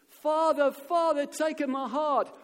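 high, loud crowd plea, last words drawn out; shouting full-voice. Acted. Crowd watches the feather fall, shouting to the Pope. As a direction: yell it at the top of your voice. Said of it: "shouting at the top of their voices".

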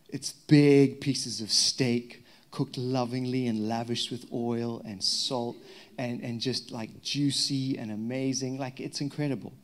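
slow, soft, relishing each word; savoring. Real, not acted. Pastor describing African barbecue to the church, slow and relishing. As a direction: linger over it like tasting the steak.

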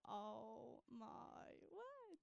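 slow, soft, drawn-out dismay; mortified groan. Retold or imitated. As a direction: let it sink out of you in embarrassment. Mortified at the stranger's question.

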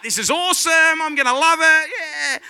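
high, loud imitation of a thrilled wife; gleeful mock shout, stretching out the cheer; excited wife. Husband imitating his wife in the ride queue, thrilled.

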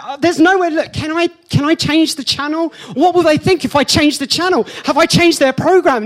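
high, loud fretting inner voice; mock panic. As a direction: babble in a flustered panic. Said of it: "And I was like".

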